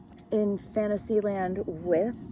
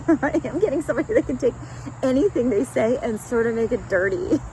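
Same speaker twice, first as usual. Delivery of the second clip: louder, fast, giggling through the words; laughing; 3 semitones higher, 6 dB louder than usual. Real, not acted. Reader giggling to viewers about a dirty-minded partner, laughing. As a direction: laugh while sharing the cheeky bit.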